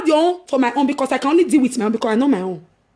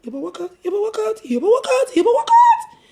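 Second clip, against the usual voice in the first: urgent; 8 semitones higher than usual. Retold or imitated. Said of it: "I kept telling".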